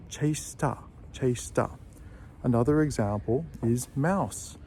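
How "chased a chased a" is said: "Chased a" run together, T into "a"; linked chased a. Shown as a demonstration. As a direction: join "Chased a" smoothly. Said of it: "So we're gonna join those words together".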